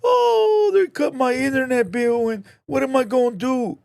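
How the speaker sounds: loud, high-pitched crybaby imitation; mock whining. Mocking people who cry over a cut internet bill, whining. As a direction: wail it in mockery, starting on a long high "oh". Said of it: "like to cry and whine like".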